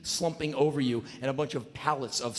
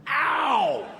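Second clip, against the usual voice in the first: sudden high yelp; mock yelp of pain; 10 semitones higher, 3 dB louder than usual. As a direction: yelp as the bar digs in. Sitting on hard slat.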